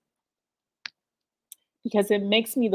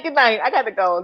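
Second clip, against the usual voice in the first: normal talking voice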